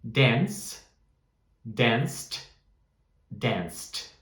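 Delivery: "danced" with a clear final t and no voice on the end; model pronunciation drill. Shown as a demonstration. Teacher modelling words for students to repeat, careful. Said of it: "pode repetir comigo".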